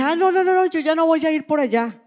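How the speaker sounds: a touch of panicky no no no; retold fearful refusal. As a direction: act out her past self backing away from the trip. She refuses to go, frightened.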